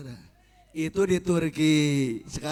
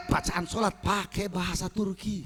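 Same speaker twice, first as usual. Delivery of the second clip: ordinary talk, nothing marked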